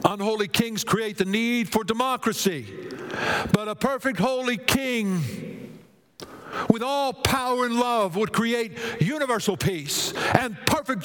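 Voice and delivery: high-pitched, ringing sermon delivery; rousing preacher's cadence; impassioned preaching. Real, not acted. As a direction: proclaim it to the whole congregation.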